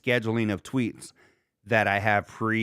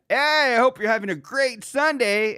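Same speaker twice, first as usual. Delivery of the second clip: high, loud radio greeting; mock cheery host; 11 semitones higher, 5 dB louder than usual. Pre-recording a greeting before a tragedy, mock cheery host. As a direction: greet listeners with over-the-top cheer.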